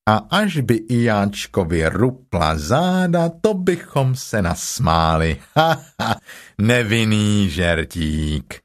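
slow, drawn-out donkey voice; mock the plan with an acted laugh; slow, sarcastic drawl; sardonic Eeyore. Gloomy Eeyore mocks Piglet's plan.

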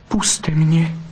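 high, urgent plea; pleading. A narrator's reading. Vixen to the fox holding her waist, pleading. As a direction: beg to be released. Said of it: "zaprosila bystrouška".

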